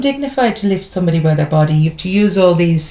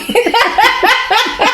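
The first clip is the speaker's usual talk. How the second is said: a burst of high laughter at her own joke; real amused giggle. Real, not acted. Joking about the stage's style.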